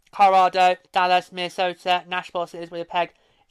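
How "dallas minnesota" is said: ordinary talk, nothing marked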